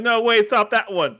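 mock urgent interruption. Acting out the director's interruption.

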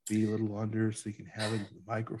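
hushed golf commentator murmur; low and soft, like a TV golf announcer. Retold or imitated. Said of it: "he has to talk like this".

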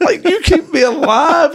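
high, slow giggly voice; laughing. Pretend. Laughing high pretend plea.

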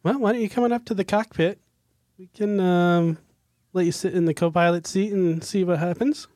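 eager pilot inviting a student; Amelia voice. Acted.

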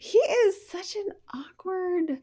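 high, slow mocking tone; high drawn-out mockery. Real, not acted. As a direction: stretch it out in disbelief. Mocking a celebrity.

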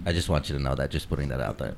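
mock-earnest low aside; deadpan aside. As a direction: drop it quietly like a confession. Mock sincere deadpan insult.

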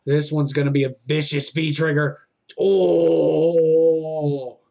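steady live play-by-play calling; wrestling commentator. Real, not acted. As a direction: call each move as it lands.

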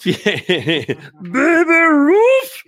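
breaks into laughter mid-word; stretched out, laughing high. Real, not acted. Laughing at his own slip.

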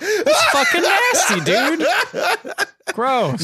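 high, loud, laughing protest; drawn-out high cry of disgust. Real, not acted. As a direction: squeal in amused revulsion. Disgusted laughing at pickup line.

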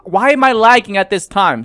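frustrated. Real, not acted. Game lags again.

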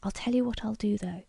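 confidential whisper. A narrator's reading. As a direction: lean in and whisper it. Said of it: "He leaned forward and whispered confidentially".

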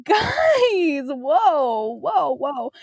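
high, stretched-out protest; mock scandalized. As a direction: call them out in exaggerated shock. Retells scolding the question askers.

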